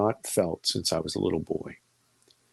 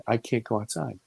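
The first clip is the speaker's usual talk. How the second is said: normal talking voice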